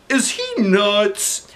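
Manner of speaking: high, very slow disbelief; stunned disbelief. A narrator's reading. Principal stunned by the idea, high slow disbelief. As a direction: drag it out in shock. Klutz voiced.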